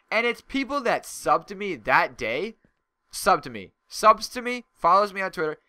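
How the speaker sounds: loud, heated complaint, voice climbing; venting real anger at fans; angry rant. Real, not acted. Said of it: "That just makes me so angry".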